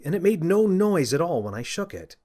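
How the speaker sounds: plain reading voice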